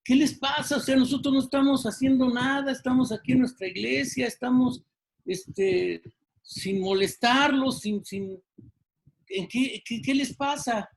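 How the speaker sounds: a flustered pastor asks what's wrong; a touch of an exasperated pastor; pastor pleading. Retold or imitated. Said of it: "el pastor dice".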